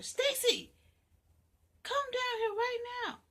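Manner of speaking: high, loud mother's call. Acted. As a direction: yell up the stairs. Mother calls from downstairs.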